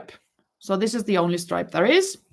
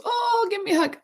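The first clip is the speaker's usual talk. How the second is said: much higher, excited retold voice of the lady; delighted invitation; 14 semitones higher, faster than usual. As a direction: voice her gushing welcome. Lady's delighted reply, retold.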